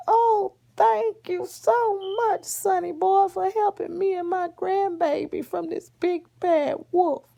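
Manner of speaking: high, loud granny voice gushing thanks; hammed-up high-pitched grandma; grateful grandma. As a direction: gush like a rescued old granny. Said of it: "And Grandma was like".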